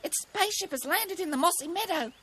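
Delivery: high, hushed-style scared bird; mock whisper in a high frightened voice; frightened kookaburra confiding a secret; fearful whisper. A narrator's reading. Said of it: "Cookie whispered".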